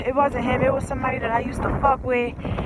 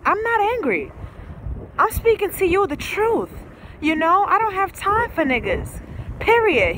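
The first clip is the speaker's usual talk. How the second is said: high-pitched retort; 7 semitones higher than usual. Retold or imitated. Denies being angry, voice high.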